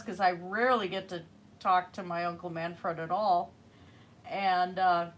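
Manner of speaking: ordinary talk, nothing marked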